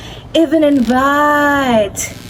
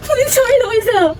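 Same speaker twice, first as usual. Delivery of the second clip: higher, tearful call; distressed cry; 4 semitones higher than usual. A narrator's reading. Wife calling husband over the lost necklace, panicked. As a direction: call for him, close to sobbing. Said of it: "I cried".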